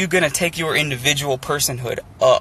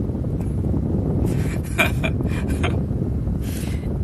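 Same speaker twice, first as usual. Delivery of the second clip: breathy laugh. Real, not acted. Laughs at own rambling.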